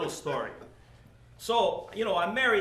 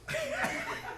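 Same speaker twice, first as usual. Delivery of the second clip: high giggle; 9 dB quieter than usual. Real, not acted. Laughing at his own story.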